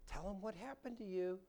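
coaxing grown-up. Acted. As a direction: gently prompt a shy child. Adult coaxing a saved child.

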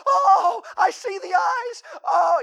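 high, loud frightened yell; terrified shout. Retold or imitated. Camper spotting the red lights outside the cabin, panicked yell. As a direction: cry out at the glowing eyes. Scared camper sees glowing eyes.

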